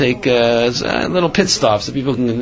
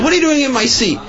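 mock yelling, pitched way up; high, agitated mock outburst at normal volume; retold angry yell; 12 semitones higher, faster than usual. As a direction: imitate an indignant passenger demanding the seat. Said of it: "he starts yelling at her".

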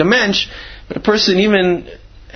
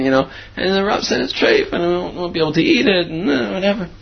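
put-on higher-pitched little girl, plaintive; crying little girl; 5 semitones higher than usual. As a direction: imitate a tearful child pleading, without real sobs. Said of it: "and she's crying, crying".

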